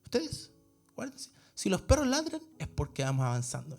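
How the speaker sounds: soft, understated imitation of an elderly gentleman; a hint of a kindly old-timer, softly; lightly voiced old man. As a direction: quietly quote an old man's advice. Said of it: "el caballero viejito me dice".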